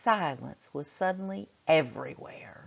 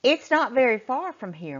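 mother voiced; 7 semitones higher, 6 dB louder than usual. A narrator's reading. Mother's line voiced in story.

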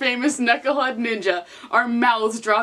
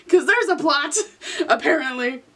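laughing; 4 semitones higher than usual. Real, not acted. Laughing sarcastic aside.

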